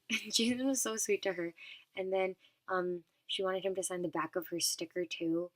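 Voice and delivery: normal talking voice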